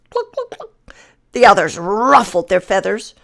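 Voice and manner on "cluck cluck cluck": hen clucking. A narrator's reading. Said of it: "clucked one hen".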